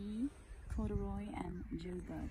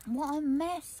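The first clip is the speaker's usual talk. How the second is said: dismayed cry; 6 semitones higher, 6 dB louder than usual. Acted. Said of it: "I cried".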